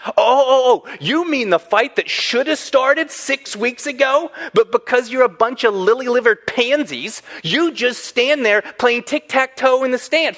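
high-pitched mock sudden realization; sarcastic comeback. Imagined David shames army for not fighting, sarcastic. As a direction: build a scornful, high-pitched rant.

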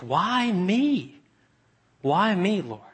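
bewildered, almost breaking; Peter's disbelief. Acted. Peter asking the Lord, bewildered and pleading.

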